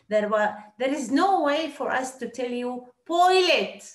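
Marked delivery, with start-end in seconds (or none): 3.1-3.8 loud, high, blunt order; a sharp push-back voice; blunt demand. Retold or imitated. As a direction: bark it like a rude guest. Blunt request a guest wouldn't make.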